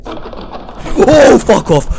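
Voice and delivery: sudden high yell of fright; startled shout. Real, not acted. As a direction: yell it in shock. Jump scare reaction.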